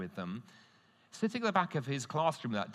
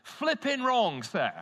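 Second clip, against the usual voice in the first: high, loud, slowed-down protest; indignant shout; 12 semitones higher, 8 dB louder, slower than usual. Retold or imitated. Pupil shouting at his teacher in class, loud indignant outburst. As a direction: blurt it out like an outraged teenager. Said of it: "shouted out".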